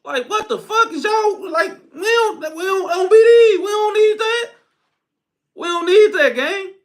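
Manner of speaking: high, crying-edged pleading, half joking; mock wailing plea. Pleading with his viewers to drop the habit, mock wailing. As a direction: wail it like begging them to stop.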